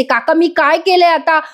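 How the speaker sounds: high, desperate cries to let her go; young woman screaming to her uncle, pitched high; pleading yell. Retold or imitated. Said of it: "आरडाओरडा करत असते".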